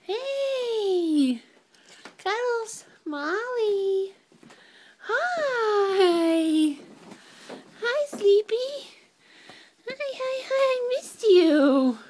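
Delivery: high, excited greeting to the dog; high happy dog greeting. Real, not acted. Greeting the dog after coming home.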